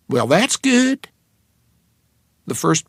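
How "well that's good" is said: much higher, slow Texas waitress drawl; thick Texas accent, sugary. A narrator's reading. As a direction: stretch it out, sweet Texas diner waitress. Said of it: "in a Texas accent that was thick enough to cut".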